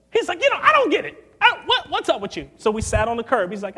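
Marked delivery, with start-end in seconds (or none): 0.4-2.5 very high, loud bafflement; hammed-up boss at wit's end; imitating exasperated boss. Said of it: "and he is mad".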